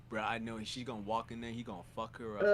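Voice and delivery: low, soft mock of a chatty guy; lightly voiced loudmouth, kept hushed; low mumbled impression of a theater talker; mimicking a moviegoer. Imitating loud moviegoer.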